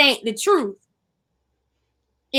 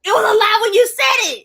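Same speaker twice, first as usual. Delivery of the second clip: raised, higher-pitched protest; heated rebuttal; 7 semitones higher, 4 dB louder than usual. Real, not acted. Co-host disputes friend's excuse, heated rebuttal. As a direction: push back high and emphatic. Quarrel over what counts as lie.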